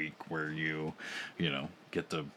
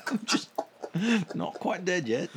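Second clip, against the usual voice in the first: put-on Aussie accent; 12 semitones higher, 4 dB louder than usual. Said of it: "That's definitely Aussie".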